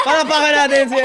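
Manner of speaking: high, very loud, rushed call for applause; laughing shout. Real, not acted. Host calling for applause for the child star, laughing shout.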